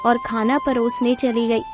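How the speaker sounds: plain reading voice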